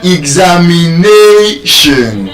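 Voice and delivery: "examination" slow, NA held long and strong; penultimate stress. Retold or imitated. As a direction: stretch NA in "examination" before a falling end. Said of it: "she said examination".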